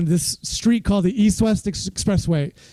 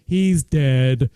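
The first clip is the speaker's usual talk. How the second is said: deadpan; 6 semitones lower than usual. Retold or imitated. Deadpan punchline to crowd.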